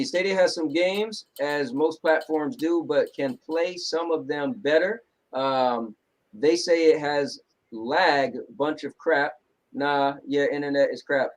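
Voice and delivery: slow comment reading. Acted.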